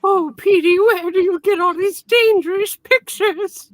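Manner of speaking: put-on high, doting old aunt voice; Aunt May as editor. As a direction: scold like a fussing auntie. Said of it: "imagine if Aunt May was the editor".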